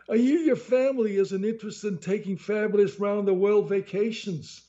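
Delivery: high, pushy mafia salesman voice; menacing salesman. A narrator's reading. Salesman cornering a tourist at the exit, pushy and high. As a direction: lean on him like a heavy. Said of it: "said to me menacingly".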